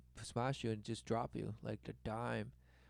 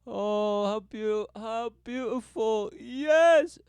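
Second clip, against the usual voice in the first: loud, high, drawn-out gushing; hammed-up swoon, rising to a big high yell; mock rapture; 10 semitones higher, 11 dB louder, much slower than usual. Gushing over a wedding proposal, mock rapture.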